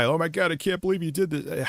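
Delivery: put-on weepy wail, lightly done; mock crying. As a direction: imitate a tearful, disbelieving woman. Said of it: "then they, like, cry".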